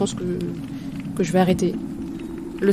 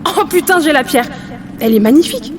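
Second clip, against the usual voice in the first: excited; 6 semitones higher, 3 dB louder than usual. Acted. Catching a stone in awe.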